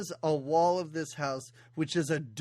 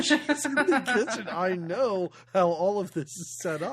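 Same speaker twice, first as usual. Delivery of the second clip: smug realization; 3 semitones higher, 4 dB louder than usual. Retold or imitated. Quoting her own smug realization.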